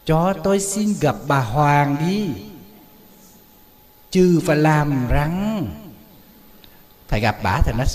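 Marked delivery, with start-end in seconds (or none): 0.0-5.7 slow, a hint of a mannered queen mother; affected lady. Monk imitating the actress playing the queen mother, slow and mannered. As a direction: lightly put on, prim and drawn out. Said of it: "bà kiểu cách bà".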